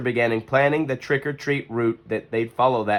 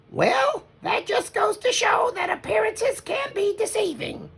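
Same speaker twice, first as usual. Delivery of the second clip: much higher, gentle mother bear voice; Mama Bear voice. Acted. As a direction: play the wise mother. Narrator voices Mama character.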